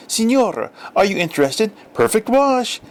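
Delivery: high, bright-eyed sales pitch at the door; eager kid salesman. A narrator's reading. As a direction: sell it like a little street vendor. Kids selling door to door.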